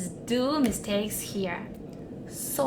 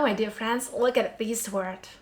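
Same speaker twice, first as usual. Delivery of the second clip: ordinary talk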